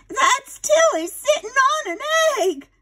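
put-on high, proud old lady; proud aunt. Aunt Sarah proudly pointing at the photo, high and proud. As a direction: show off the photo.

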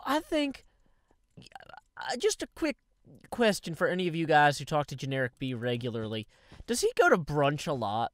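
usual speaking voice

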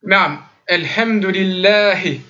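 alhamdulillahi with a clear final hi, slow; slow teaching demo; stressed final hi. Shown as a demonstration. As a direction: sound the hi at the end of alhamdulillahi. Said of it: "Il faut que ce soit prononcé le hi".

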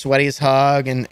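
ordinary delivery

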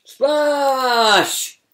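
high loud splash; excited shout. Acted. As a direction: shout it with glee. Climax of the story.

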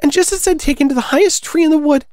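very high mock shriek; shrieking pigeon. A narrator's reading. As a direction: complain in a piercingly high bird voice. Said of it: "raising its voice to a shriek".

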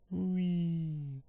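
falling whee; playful whee. Real, not acted. Jumping down in game.

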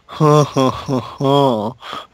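slow whispered villain cackle; whispered evil laugh. Acted. Whispered evil laugh at the hero. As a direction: breathe out a slow sinister ha ha ha.

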